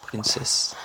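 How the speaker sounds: whispered English "Princess" with a rising end; softly retry "Princess" in an English accent under the breath; self-correcting English accent, whispered. Real, not acted. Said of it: "Excusez mon accent anglais de merde".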